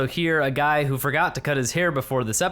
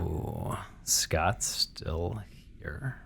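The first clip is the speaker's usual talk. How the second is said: slower; 8 semitones lower, 10 dB quieter, much slower than usual. Shown as a demonstration. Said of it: "a little bit slower on the back half".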